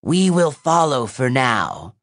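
put-on grudging threat from an old monster; ancient monster voice. A narrator's reading. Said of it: "Seraphine said".

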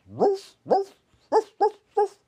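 very loud high woofs; dog barking. A narrator's reading. Guard dog barking at the intruder, very loud. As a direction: bark like a big dog.

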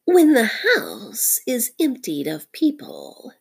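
high, slow, scheming snake voice; Nagina. A narrator's reading. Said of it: "Nag and Nagina whispering together".

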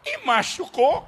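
mock-sweet mom; tender mom question. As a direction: ask the child gently. Mother's question.

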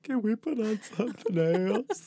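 high, pleading imitation of herself; pleading quote. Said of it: "and I was like".

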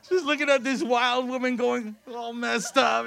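talking loudly through laughter; laughing while describing the scene. Real, not acted. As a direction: keep the laugh going through the words.

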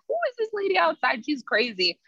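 mock freaked-out question to the uncle; alarmed disbelief. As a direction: squeal it like she can't believe it. Telling uncle about strange woman.

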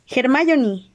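shout. Acted. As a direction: call her name in alarm. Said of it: "gritaron Harry y Ron".

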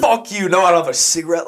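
mock a combative refusal; confrontational. Teller imitates friend refusing strangers a cigarette, confrontationally. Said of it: "so confrontational".

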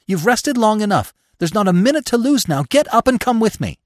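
high, loud, frantic urging; urgent cry. A narrator's reading. Bambi urges exhausted Gobo to flee, crying out. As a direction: plead insistently at the top of the voice. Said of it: "cried Bambi".

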